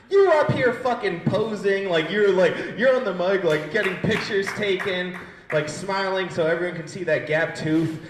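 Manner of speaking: loud, high-pitched rant at someone in the crowd; exasperated loud telling-off; heated heckler takedown. Real, not acted. Scolding audience member mid-show.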